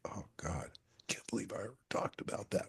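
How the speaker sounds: hushed, sheepish whisper; mortified hushed aside; embarrassed whisper. Acted. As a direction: whisper it like you overshared. Embarrassed after sharing something personal.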